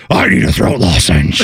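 low, throaty mock Batman growl; passerby mocking Batman voice. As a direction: drop it deep like a guy parodying Batman. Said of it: "some guy walking out was like".

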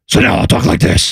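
rough, raspy mock Batman growl; disguised Batman voice. Shown as a demonstration. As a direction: disguise your voice badly, like an amateur Batman. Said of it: "when it's time for him to disguise his voice".

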